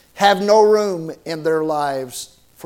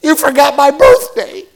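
loud, high hammed-up wail; mock bawling; 7 semitones higher, 7 dB louder than usual. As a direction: imitate a young woman bawling her complaint. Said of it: "and she starts bawling".

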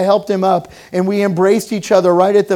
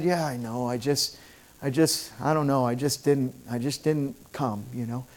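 mumbled hesitation; 6 semitones lower, 12 dB quieter than usual. Retold or imitated. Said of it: "And he said".

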